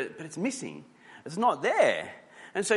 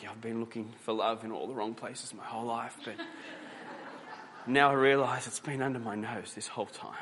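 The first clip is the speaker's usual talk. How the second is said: mock romantic line; 2 semitones lower, 6 dB quieter than usual. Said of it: "that's my acting skills".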